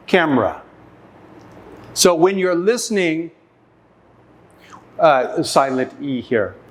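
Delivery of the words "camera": camera said as two sounds, CAM-ra; two-syllable camera. Shown as a demonstration. Teacher showing camera only has two sounds, middle e dropped. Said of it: "it only has two sounds".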